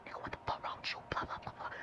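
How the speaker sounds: imitated outburst in a hard whisper; whispered mock yelling. Brother bursts into the room on ten, whispered mock yelling. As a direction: mime a furious yell, all in whisper.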